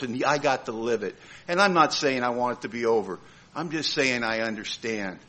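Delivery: low, subdued talk to God; heartfelt prayer. Retold or imitated. Speaker thanking God for his wife, hushed heartfelt prayer. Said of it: "I had a conversation with God".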